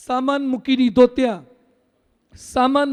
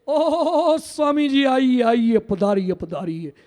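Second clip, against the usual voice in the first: Hindi-speaking host's welcome; slower than usual. Retold or imitated. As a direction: imitate an effusive host ushering in guests, slowly trailing down. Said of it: "પેલા હિન્દી ભાષી શું કે". (હિન્દીભાષી યજમાનનો લંબાવેલો આવકાર)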